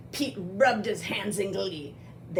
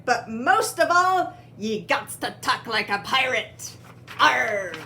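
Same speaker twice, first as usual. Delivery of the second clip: hearty, louder pirate declaration; punchy pirate talk, raised voice; pirate captain Pete; 4 semitones higher, 5 dB louder than usual. Acted. Pete addresses would-be pirates.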